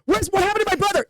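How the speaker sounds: high, fast panicked question; frantic. Acted. Orc demanding news of his brother, frantic. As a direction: blurt it out high and hurried.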